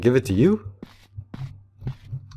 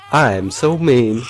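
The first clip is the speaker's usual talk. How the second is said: ordinary talk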